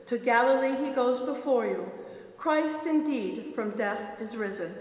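normal talking voice

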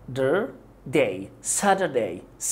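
"Saturday" drawn out slowly, syllable by syllable; slow syllable drill. Shown as a demonstration. Teacher slowing Saturday down for learners. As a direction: stretch each syllable of "Saturday". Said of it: "الكلمة دي تلات مقاطع".

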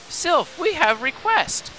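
put-on childlike plea. Voicing a party member's request.

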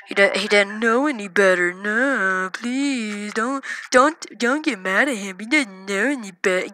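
soft, wobbly pleading voice; mocking pleader. Pleading for the little brother not knowing better, soft mocking voice. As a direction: mockingly plead on the child's behalf, softly.

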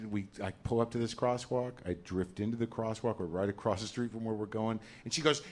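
normal talking voice

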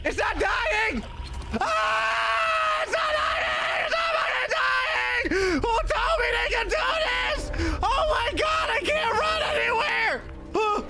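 panicked crying scream; high sobbing shrieks in real terror; being chased. Real, not acted.

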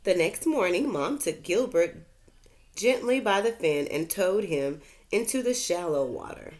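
bright, lively read-aloud voice; reading to children. A narrator's reading. Teacher reads a story to her class, lively storytelling. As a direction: tell it like a bedtime storybook.

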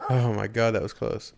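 ordinary talk

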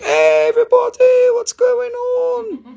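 very high, loud, drawn-out mock greeting; party-goer impression. Seller imitates viewers partying together, high mock greeting. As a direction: squeal it like an overexcited party guest. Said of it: "You're like".

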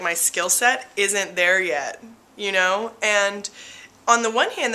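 ordinary talk, nothing marked

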